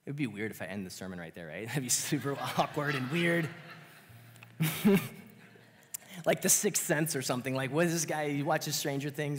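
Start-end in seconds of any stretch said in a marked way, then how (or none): 1.7-9.4 laughing. Real, not acted. Laughing joke about hearing voices.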